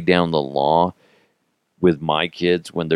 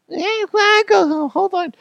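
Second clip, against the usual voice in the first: put-on much higher, childish protest; kid voice. Kids refusing to go home, high kid voice. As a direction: whine like a kid who won't leave.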